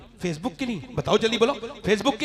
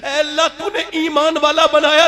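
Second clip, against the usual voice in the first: louder, calling out thanks to God; loud fervent prayer; 4 semitones higher, 10 dB louder than usual. Pretend. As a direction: proclaim the thanks with fervour.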